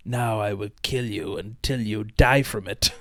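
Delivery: slow, menacing movie villain. Retold or imitated. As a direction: drag out a cartoon baddie's threat. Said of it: "who is it that goes like".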